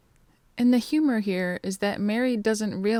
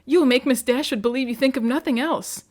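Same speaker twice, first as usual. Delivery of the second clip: loud, high scolding; Lucy scolding her sister, loud and high-pitched; exasperated cry; 4 semitones higher, 6 dB louder than usual. Acted. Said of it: "cried her sister".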